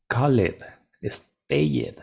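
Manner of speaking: "called" and "stayed" each given an extra syllable, "KAL-led, es-TAY-yed"; nonexistent spelled-out ED. Shown as a demonstration. Teacher mocking what nobody would say, extra ED syllable. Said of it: "No, porque eso no existe".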